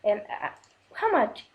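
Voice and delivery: usual speaking voice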